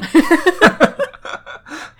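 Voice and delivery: high, loud cackling laugh; a sudden high real laugh; burst of laughter. Real, not acted. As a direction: crack up at the joke. Reacting to the licking scene.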